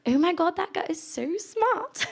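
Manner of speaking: put-on British admirer, drawn out; mock British accent. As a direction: gush in an exaggerated British accent. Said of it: "have a great British accent".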